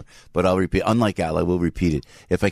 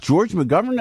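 surprised; 10 semitones higher, slower than usual. Real, not acted.

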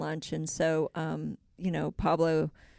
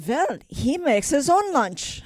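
put-on slow, high German-accented punchline; put-on German accent, louder and drawn out; German Fraulein; 7 semitones higher, 5 dB louder, slower than usual. German widow not crying at funeral, slow German accent. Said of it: "Let's hear you do a Fraulein now".

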